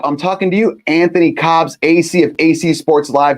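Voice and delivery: punchy on-air shout-out; loud call-out. Real, not acted. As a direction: call him out loudly by name. Calling out a colleague on air.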